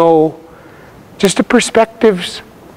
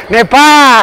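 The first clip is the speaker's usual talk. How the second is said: raised, higher call with a falling end; louder; 4 semitones higher, 4 dB louder than usual. Shown as a demonstration. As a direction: call it out so they hear you. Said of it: "Make sure they hear you".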